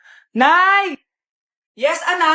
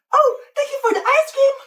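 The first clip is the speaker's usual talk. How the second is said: TikTok NPC live; 8 semitones higher than usual. Pretend. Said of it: "yung A I na trend sa TikTok".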